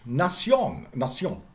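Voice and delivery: NATION as "na-SYON", nasal final vowel; French pronunciation. Shown as a demonstration. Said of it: "it's French".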